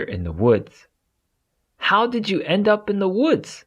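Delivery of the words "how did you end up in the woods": higher deer voice, curious; deer asking. Acted. Deer asks Eddie how he got lost, higher voiced. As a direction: voice the deer's question up high. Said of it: "asks the deer".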